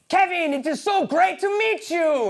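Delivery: high, loud, gushing bad Belgian accent; botched Belgian accent. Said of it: "that's not a Belgian accent".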